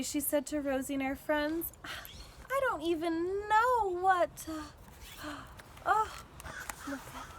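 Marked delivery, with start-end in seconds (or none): 1.8-7.3 halting, softening, voice fading; choked up, trailing off. A narrator's reading. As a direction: fight back the tears and lose the words. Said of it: "her voice trailed off".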